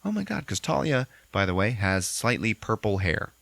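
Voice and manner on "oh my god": much higher put-on shock; mock gasp. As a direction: squeak it out. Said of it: "she's like".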